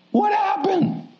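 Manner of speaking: high, incredulous cry of what happened; mock-shocked high exclamation; incredulous outcry. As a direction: throw it up high like a shocked question. Preacher's rhetorical exclamation.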